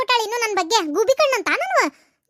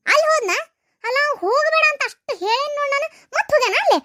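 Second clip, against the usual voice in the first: outraged mother; 6 semitones higher than usual. Acted. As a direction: blow up at the news. Mother outraged son went away. (ಸಿಟ್ಟಿನಿಂದ ಕೂಗುವ ಅತ್ತೆ)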